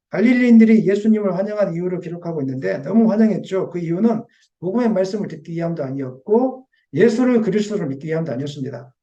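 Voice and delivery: normal talking voice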